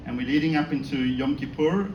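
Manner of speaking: ordinary delivery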